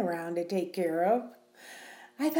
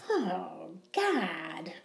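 tearful sigh; 3 semitones lower, 6 dB quieter than usual. Acted. Distressed over tight wedding dress.

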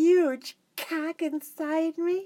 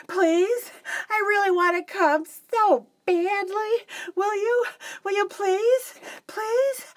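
beg, rising higher each time; high begging; 7 semitones higher than usual. Acted. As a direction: pitch climbing with each plea. Begging climbing in pitch.

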